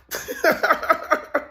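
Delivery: high, rapid-fire cackle; real high-pitched laughing fit; cackling laugh. Real, not acted. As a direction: burst into a wheezy staccato cackle. Laughs at own patois call.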